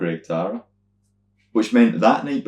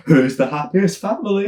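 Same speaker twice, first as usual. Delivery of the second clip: high put-on compere voice; squeaky, sing-song host; entertainer voice; 12 semitones higher, 4 dB louder than usual. Imitating the entertainer asking which family is happiest. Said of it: "he's like".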